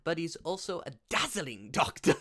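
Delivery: high, loud, silly put-on voice; hammed-up character voice with punched name; Doctor Dazzle voice. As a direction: play a flamboyant doctor, swooping high. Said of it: "I don't know why I'm doing that voice".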